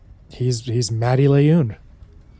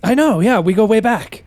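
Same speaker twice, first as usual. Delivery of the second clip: high, stammering nervous dwarf; higher and tripping over his words, bluffing; flustered stowaway; 8 semitones higher, 4 dB louder than usual. Acted. Stowaway faking a shared past with Faunnelin, high flustered stammer.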